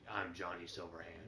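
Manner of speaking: flat, low, deadpan drone; Johnny Silverhand monotone. Retold or imitated. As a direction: drone it out with zero emotion. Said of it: "That's how he talks".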